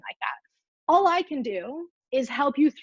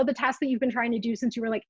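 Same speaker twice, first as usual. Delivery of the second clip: ordinary delivery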